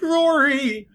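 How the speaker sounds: high, wrenching shout of the name; anguished cry of Rory. Pretend. Liam unmasking his dying brother, high anguished shout. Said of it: "lifts the mask and goes".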